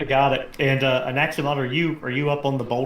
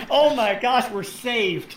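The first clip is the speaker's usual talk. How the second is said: mock relief; 11 semitones higher, slower than usual. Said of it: "You're like".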